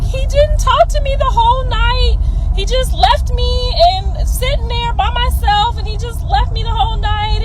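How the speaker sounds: high-pitched imitation of a crying girl; mock tearful complaint. Mom retelling the girl left alone at the dance, tearful whining. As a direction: whine it like a hurt, upset teen. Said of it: "she was hysterically crying".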